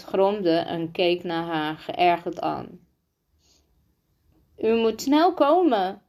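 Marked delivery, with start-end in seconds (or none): 4.6-6.0 high, urgent breathless cry; out of breath. A narrator's reading. Rosa begging the adjutant to come, out of breath. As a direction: plead for help while winded. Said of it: "riep Rosa buiten adem".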